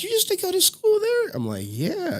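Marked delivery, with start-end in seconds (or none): 0.0-1.3 put-on very high-pitched child voice; amazed kid. Daughter amazed by dad's old high school, high squeaky imitation. 1.7-2.2 high-pitched retold "yeah"; proud reply. Dad answering his daughter about his school, proud and high.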